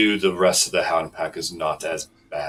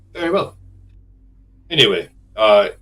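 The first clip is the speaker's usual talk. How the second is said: normal talking voice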